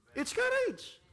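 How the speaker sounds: courage flung out high and loud; courage shouted high. Shown as a demonstration. Preacher crying out courage to the congregation, high and loud. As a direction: repeat courage as a rallying cry.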